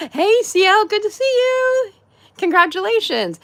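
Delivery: high, bright hello; excited greeting. Real, not acted. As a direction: greet her like a delighted friend. Greeting a chat member.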